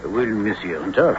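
haughty French manservant, quiet and formal; snooty waiter. Acted. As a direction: speak down your nose like a butler. Servant greets visiting officer.

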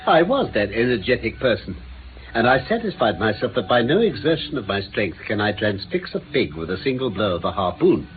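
ordinary talk

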